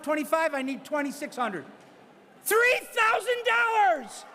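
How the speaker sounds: brisk auctioneer patter pushing for the next bid; auctioneer bid calling. Real, not acted. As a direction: call the bids like a charity auctioneer. Auctioneer running live bidding.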